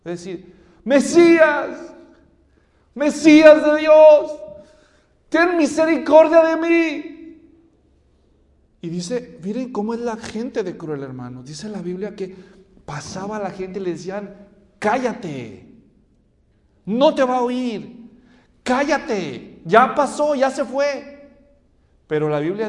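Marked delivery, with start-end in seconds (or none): 0.8-7.1 loud, high pleading shout; crying out. Retold or imitated. Blind man begging the Messiah for mercy, crying out. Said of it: "dice que él empieza a gritar". 14.8-21.4 raised, impatient dismissal; acted scolding. Acting people hushing Bartimeo.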